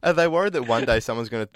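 ordinary talk

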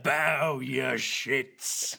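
very slow booming royal command; Robert impression. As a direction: drag it out like a drunk king. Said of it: "that's my Robert impression".

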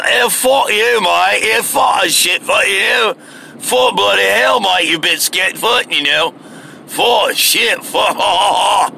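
mock British shouting. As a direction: yell it like a rowdy Brit on an app. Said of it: "these guys would get on there".

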